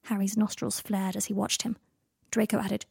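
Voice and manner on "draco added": usual speaking voice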